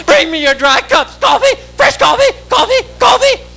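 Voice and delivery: very high waitress squawk; coffee-pushing statue. Acted. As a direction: call out for cups in a manic high voice.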